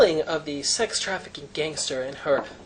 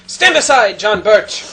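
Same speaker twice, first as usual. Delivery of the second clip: heroic shout; 2 semitones higher, 7 dB louder, slower than usual. Pretend. Heroic mock call to arms.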